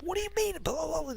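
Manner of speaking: a high, jokey Rocket impression; Rocket Raccoon. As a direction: pitch it way up like a snippy little raccoon. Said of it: "he's like".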